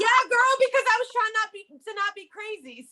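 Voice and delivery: talking high through giggles; giggly, breathless confession; laughing. Real, not acted. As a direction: laugh the words out as you speak. Laughing while admitting hard times.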